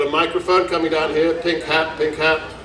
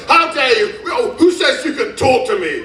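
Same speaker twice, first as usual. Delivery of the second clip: indignant outburst; 8 semitones higher than usual. Retold or imitated. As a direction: huff it out like an offended customer, half laughing. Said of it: "And then she flipped".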